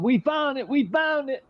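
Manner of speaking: high excited mock shout of discovery; retold yell. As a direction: call it out like cops finding the stash. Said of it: "they yelled".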